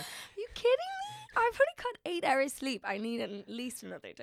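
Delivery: high, loud indignant whine; playful outraged complaint; mock outrage. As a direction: squeal it like a spoiled sleeper. Mock protest about needing sleep.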